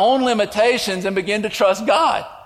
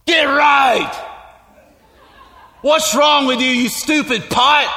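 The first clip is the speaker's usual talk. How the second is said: sharp raised scolding, slowing on stupid pot; 3 semitones higher, slower than usual. Acted. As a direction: bark at the broken pot like it can fix itself. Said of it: "me walking over here and going".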